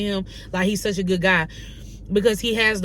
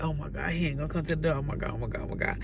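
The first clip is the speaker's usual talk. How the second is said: softer, fast worried murmur, fading lower; nervous hushed fretting; 5 semitones lower, 9 dB quieter, much faster than usual. Retold or imitated. Waiting outside his door, nervously murmuring to herself. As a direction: fret under your breath that he won't come.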